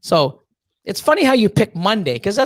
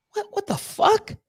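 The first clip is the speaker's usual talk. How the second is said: stunned disbelief; 14 semitones higher, 5 dB quieter, slower than usual. Retold or imitated. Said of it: "I'm like".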